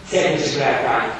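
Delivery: usual speaking voice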